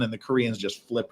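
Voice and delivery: ordinary talk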